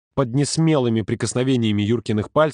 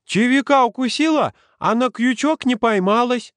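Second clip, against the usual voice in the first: Олежка; 13 semitones higher, 4 dB louder than usual. A narrator's reading. Little boy whose fish got away, undaunted. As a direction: exclaim like an undaunted small child. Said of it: "Олежка, тем не менее, не унывал".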